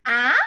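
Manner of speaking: "ah" loud, sweeping up in pitch; second tone. Shown as a demonstration. Teacher modelling for learners, "ah" loudly rising. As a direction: make "ah" climb like a short question. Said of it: "So for the second tone".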